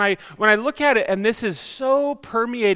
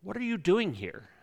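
off-putting question; 7 dB quieter than usual. Shown as a demonstration. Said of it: "depending on the context and the inflection".

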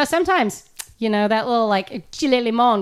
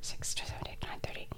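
counting in a whisper; 19 dB quieter than usual. Real, not acted. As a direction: breathe the numbers. Counting under breath.

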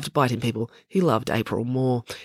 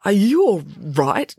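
higher, slow, hesitant question; concerned Joe; 6 semitones higher, 4 dB louder, slower than usual. Acted. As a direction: ask slowly with concern. Joe asks coughing Maya, halting voice.

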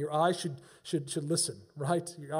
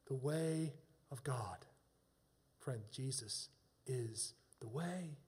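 slow, low and soft; hushed appeal; 6 semitones lower, 12 dB quieter, slower than usual. Real, not acted. Preacher answering his question for a friend, hushed and slow. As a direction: drop almost to a whisper, weighing each word.

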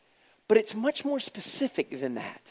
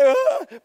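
very high mock crying groan, louder; 5 dB louder than usual. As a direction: imitate a hurt boy's wail. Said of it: "He's like".

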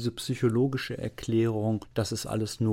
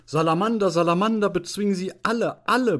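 high, loud frenzied outburst; furious roar, acted; 10 semitones higher, 4 dB louder, faster than usual. Deputy headmaster in the utmost rage, roaring. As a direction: bellow it, pitch jumping up. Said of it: "brüllte der Konrektor Paulmann in höchster Wut".

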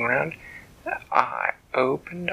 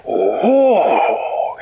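high, loud astonished oh; amazed oh; 10 semitones higher, 7 dB louder than usual. Acted. Flibbertigibbet amazed at the tuna, high and loud. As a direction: gasp it out in wonder.

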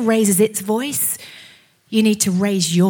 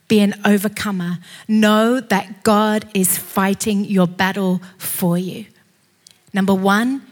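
ordinary talk, nothing marked

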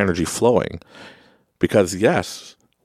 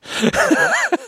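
real squealing laugh, very high. Real, not acted. Recalling waving at the driver flipping him off, high giggle. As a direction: let a high giggle burst out.

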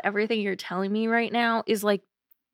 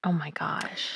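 hushed, shocked whisper; 3 semitones lower, 5 dB quieter than usual. Real, not acted. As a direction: breathe it out in disbelief. Shocked reaction to grim story.

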